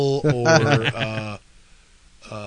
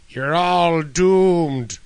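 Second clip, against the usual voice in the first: slow ominous drawl; 2 semitones higher, much slower than usual. Pretend. Movie riffing, ominous character voice.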